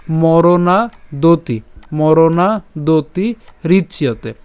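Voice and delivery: মরণাদতি slowly in chunks, "moronaa, doti"; model pronunciation. Shown as a demonstration. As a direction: draw out মরণাদতি, then a separate রিচ্যতে. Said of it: "প্রথম থেকে উচ্চারণটা করি".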